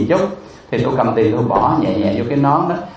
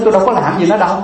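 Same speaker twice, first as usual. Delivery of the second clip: higher-pitched retold protest of innocence; indignant protest; 7 semitones higher, faster than usual. Monk defends himself to fellow monks, indignant protest. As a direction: protest your innocence, voice rising.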